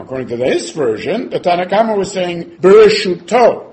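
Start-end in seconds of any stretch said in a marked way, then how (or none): 2.6-3.7 Bereshuto stretched slow and punched loud; stress on Bereshuto. Shown as a demonstration. Teacher drilling students on Bereshuto, slow loud stress. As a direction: lean hard on every syllable of Bereshuto. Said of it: "he meant to stress the word Bereshuto".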